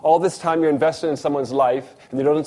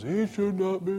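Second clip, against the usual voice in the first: stubborn friend; 6 semitones higher, 6 dB quieter, slower than usual. Retold or imitated. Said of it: "And he says".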